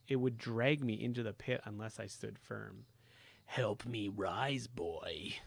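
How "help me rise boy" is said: slow, low, hungry whisper from the pit; hungry evil voice. Acted. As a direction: crave it, slowly. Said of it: "The voice became hungrier".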